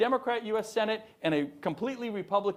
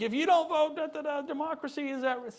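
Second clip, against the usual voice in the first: mocking Nancy Pelosi; 8 semitones higher than usual. To the audience, mocking Pelosi's debate-stage warning in a higher voice. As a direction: parody a politician's doom-laden warning. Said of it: "she's like".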